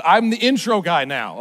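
ordinary talk

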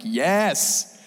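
a Bible reader thrilled to reach this verse; excited cheer. Retold or imitated. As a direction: shout it like you just found hope. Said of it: "you're like".

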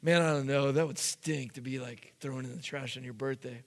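hushed, mock-serious debating; mock deliberation. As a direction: talk it over quietly. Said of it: "they're like".

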